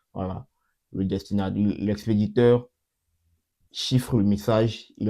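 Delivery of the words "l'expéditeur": normal talking voice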